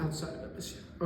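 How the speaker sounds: soft whispered repeat, thinking it over; a quiet whisper while checking the question; whispered to himself. Real, not acted. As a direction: murmur the question back under your breath.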